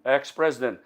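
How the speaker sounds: ordinary talk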